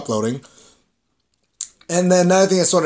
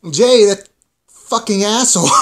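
angry rant; 6 semitones higher, 6 dB louder than usual. Real, not acted. Ranting about hated character.

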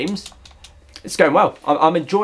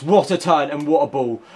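normal talking voice

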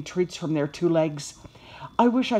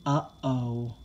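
lower-pitched, sinking puppy worry; dejected; 7 semitones lower, 3 dB quieter than usual. A narrator's reading. Puppy led out of class, dejected. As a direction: let the voice droop with dread.

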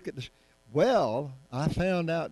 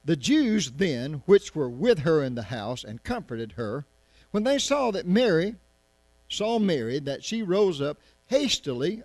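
scripture reading; 3 semitones higher, 5 dB louder than usual. Acted. Preacher reading the Bible to the congregation, raised voice. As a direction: read it out to the congregation with weight.

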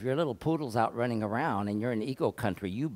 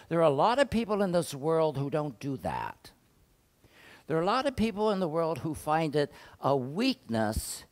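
raised, emphatic pulpit voice; preaching; 5 semitones higher, 4 dB louder than usual. Acted. Pastor preaching to the congregation, raised and emphatic. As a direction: preach it out to the pews.

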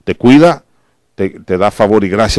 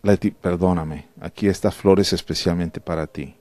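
tender apology; 5 semitones lower, 11 dB quieter than usual. Retold or imitated. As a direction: offer it gently with a flower. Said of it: "Si yo sé que a ella le gusta la flor".

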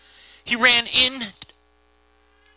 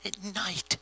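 very soft, spooky aside; hushed, as if out there in the dark; hushed repeat; 15 dB quieter than usual. Real, not acted. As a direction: drop almost to a whisper on the words.

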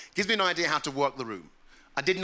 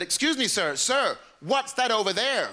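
higher, drawn-out tourist question; American tourist; 5 semitones higher than usual. Retold or imitated. Tourist asking a local about the castle, mock American. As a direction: play a puzzled American tourist. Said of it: "I knew they were American".

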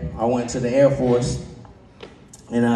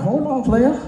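high comeback; 12 semitones higher, slower than usual. Retold or imitated. Comeback to recruiter acted.

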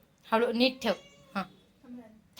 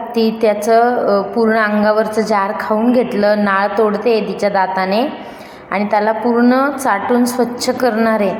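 louder, steady vlogger narration to camera; vlog voiceover; 8 dB louder than usual. Acted. A vlogger telling viewers about the newborn kittens, in a steady narration voice. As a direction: explain to viewers how the mother cat knows what to do, louder than the chat around it.

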